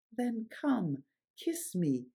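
ordinary talk, nothing marked

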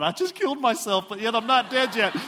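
hammed-up panicked outburst; panicking. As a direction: play up a mock panic, pitched high. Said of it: "So I'm, like, panicking".

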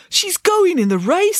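high, drawn-out excited cry; excited amazement. Acted. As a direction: stretch it out in amazed delight. Binky amazed Debbie is racing.

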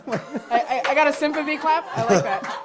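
laughing high and loud. Real, not acted. Laughing at sympathy clap.

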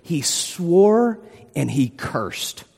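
slowed, weighty reading; slow emphatic quote. Retold or imitated. Preacher quoting Mark, slowly and emphatically. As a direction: drag each word out for weight. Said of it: "so emphatically denied Jesus that Mark said".

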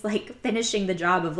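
normal talking voice

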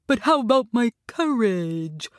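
mildly worried lion voice; anxious. A narrator's reading. Lion fears he'll be forgotten, anxious. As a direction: ask with a drawn, worried quaver on courage. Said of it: "asked the lion anxiously".

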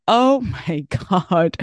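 slow, laughing "oh my God"; laughing excitement. Real, not acted. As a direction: laugh it out, thrilled. Excited about the gym.

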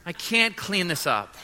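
a hint of a whining six-year-old; mock childish protest, trailing into a laugh; little girl's whine. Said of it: "And I go into her room and I say".

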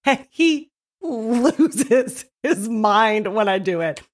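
ordinary delivery

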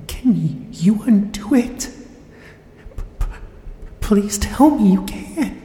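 beg, barely holding together; desperate pleading. Acted. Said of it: "The desperation in her eyes".

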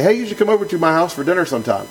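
lightly acted vague dinner invitation; casual throwaway. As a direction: toss it off like idle politeness. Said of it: "I'm just kinda throwing that out there".

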